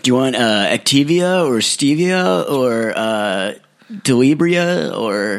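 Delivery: slow, high, dreamy clerk voice; spaced-out clerk. Retold or imitated. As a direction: drag out the silly product names like a dazed clerk. Imitating the stoner shop clerk.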